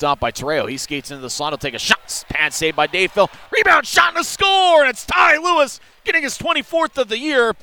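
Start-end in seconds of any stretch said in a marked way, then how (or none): 3.5-7.5 high, loud goal call; goal call shout. Real, not acted. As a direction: yell as the puck goes in. Goal call in hockey broadcast.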